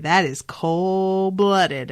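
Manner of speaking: COLD-BLOODED stretched out slowly; slow, emphatic "cold-blooded"; drawn-out reaction. Retold or imitated. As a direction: linger on "cold-blooded" for effect. Mock-impressed reaction to the character.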